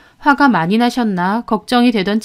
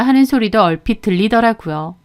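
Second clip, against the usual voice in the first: ordinary delivery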